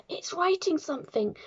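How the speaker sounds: high-pitched cry without full volume; mild scream. A narrator's reading. Nigel sees chalk start writing, mild scream. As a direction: alert the class in astonishment. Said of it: "screamed Nigel".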